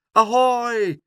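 high, loud seagull's hail; calling out. A narrator's reading. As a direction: holler a greeting from afar. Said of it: "riep hij". (luid en hoog roepen)

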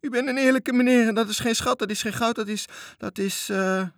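put-on pleading child. Toontje begging the pirate to let him down, nervous pleading. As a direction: flatter him desperately.

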